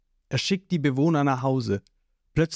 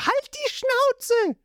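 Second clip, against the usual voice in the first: very high, slow furious yell; screaming; much slower than usual. A narrator's reading. Nami kicking Zorro, a high slow furious scream. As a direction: scream it at him. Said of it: "Sie tritt ihn ... und schreit".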